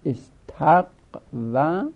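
استقومه drawn out very slowly, each sound clear; original form spelled out. Shown as a demonstration. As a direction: dictate استقومه slowly for students. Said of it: "اصلش استقومه است".

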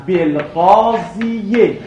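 بالقاضیه very slow, sounding the final kasra; laboured pronunciation. Shown as a demonstration. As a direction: stretch بالقاضیه out syllable by syllable, ending on -iye. (بالقاضیه کشیده و شمرده، با کسرهٔ آخر)

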